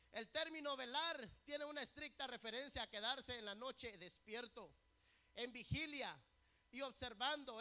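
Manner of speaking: emphatic preaching. Real, not acted. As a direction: drive the teaching home to the congregation. Preaches with force. (predicación enfática en voz alta)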